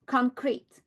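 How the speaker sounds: normal talking voice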